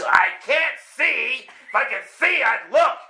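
loud, high, drawn-out frustrated shout; yelling. Retold or imitated. Driver battling the voice in his head, yelling. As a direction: shout it slowly, at the end of patience. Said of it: "I'm yelling in the car".

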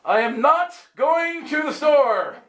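grand, high, slow royal declaration; Camelot voice. Retold or imitated. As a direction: proclaim it like a Camelot king. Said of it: "with my Camelot voice".

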